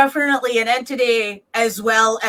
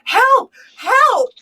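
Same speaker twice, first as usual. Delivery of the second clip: higher, mock desperate cry for help; 12 semitones higher, 4 dB louder than usual. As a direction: call out like a child in trouble. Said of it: "a voice cry out to us".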